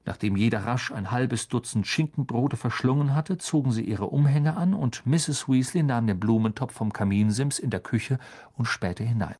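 usual speaking voice

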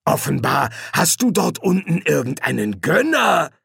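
gloating villain voice; malicious smile. A narrator's reading. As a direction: taunt him with malicious pleasure. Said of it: "lächelte Hastur boshaft".

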